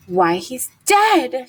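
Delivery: shocked cry. A narrator's reading. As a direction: gasp it out in alarm. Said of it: "she cried".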